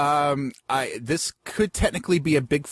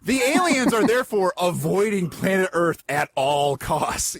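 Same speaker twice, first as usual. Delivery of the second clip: ordinary talk, nothing marked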